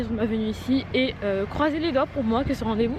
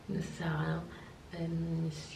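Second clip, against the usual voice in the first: whispering; 9 semitones higher, 7 dB quieter than usual. Real, not acted. Whispering to camera, morning routine.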